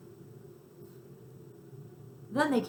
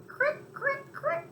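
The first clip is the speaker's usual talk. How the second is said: high squeaky crick sounds; cricket chirp; much slower than usual. Retold or imitated. Cat imitates the cricket's chirp. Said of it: "as if you're going".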